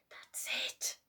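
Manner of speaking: urgent whisper; hushed whisper. A narrator's reading. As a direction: whisper it in triumph. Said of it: "Herbert whispered".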